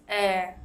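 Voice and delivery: "eh." said relaxed and open; a loose, open short "eh."; teacher models a relaxed short "eh.". Shown as a demonstration. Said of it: "Remember, the short E sound is".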